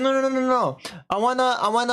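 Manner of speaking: high, hurried string of protests; mock urgent protest. Man stops Margaret from leaving.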